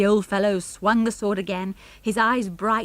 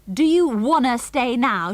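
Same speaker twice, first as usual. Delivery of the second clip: raised, taunting shout; 5 semitones higher, 6 dB louder than usual. A narrator's reading. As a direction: shout it after someone running away. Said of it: "shouted George".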